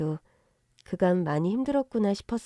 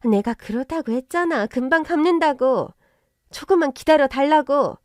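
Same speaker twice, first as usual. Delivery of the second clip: high, loud defensive outburst; building irritated protest; exasperated retort; 6 semitones higher, 6 dB louder than usual. Acted. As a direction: insist loudly, fed up with being pressed. Debtor snaps back at creditor.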